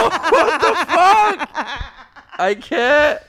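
high squealing laugh; laughing disbelief. Real, not acted. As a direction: laugh through the words.

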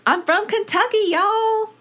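high, hammed-up Kentucky drawl; put-on Kentucky accent. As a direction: play up a high-pitched Kentucky twang. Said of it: "I had to do it".

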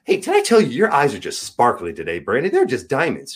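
a hint of a doting wife showering her husband with compliments; sweet doting wife. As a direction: gush over him like a fond wife. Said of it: "She will stop whatever she's doing and go".

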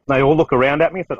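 ordinary delivery

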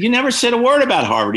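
high, loud and fast outrage; mock indignant protest. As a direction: fire back in disbelief. Arguing with dad about Harvard.

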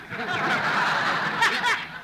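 high giggle at his own gag; laughing at own joke. Acted. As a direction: cackle proudly at your own joke. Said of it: "That was a funny joke".

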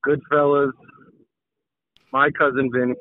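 usual speaking voice

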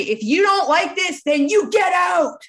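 high, loud mock shout of a bullying boss; angry ultimatum. Mimicking his coach threatening members on video, high loud shout.

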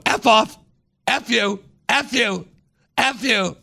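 high squawking parrot voice. Retold or imitated. Bird on the roof squawking at firefighters, high parrot voice. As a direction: screech it like an angry parrot. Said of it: "the bird is up on the roof going".

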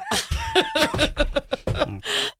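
shrieking laughter; high laugh. Real, not acted. Laughs at crow death.

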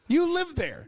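high, emphatic incredulous retort; retold disbelief. Viewer scoffing at rich kids' show, incredulous. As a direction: push it up high in exasperated disbelief.

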